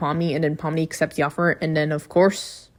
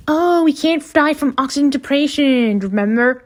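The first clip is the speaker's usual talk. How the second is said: high-pitched mocking character voice; Jax impression; 9 semitones higher, 3 dB louder than usual. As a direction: chirp it high in a dismissive mock tone. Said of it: "And then Jax is like".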